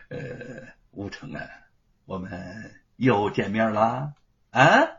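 put-on villainous minister greeting his old foe with a sneer; cold sneer. A narrator's reading. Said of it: "露出一丝冷笑".

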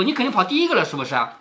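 ordinary talk, nothing marked

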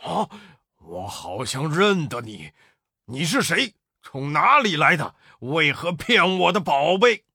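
startled demon. A narrator's reading. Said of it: "那妖怪见了大惊".

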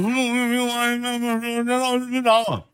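put-on high, slow, soft child's plea; scared kid. Boy begging the teacher not to hit him, high and soft. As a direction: beg like a frightened little boy.

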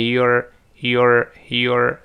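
"your" as one slow syllable with r ending; contracted you're. Shown as a demonstration. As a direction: repeat "your" slowly, you and are merged. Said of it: "注意发音变成your".

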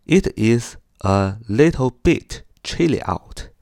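slow, each word separate. Shown as a demonstration. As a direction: say it slowly, one word at a time. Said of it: "一个单词一个单词地说". (慢慢地一个词一个词读)

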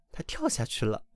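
plain reading voice

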